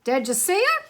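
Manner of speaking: high, loud excited questions; can't contain the excitement; excited cry. A narrator's reading. Hector to Lyle after the crocodile surfaces, excited. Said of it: "Hector cried out".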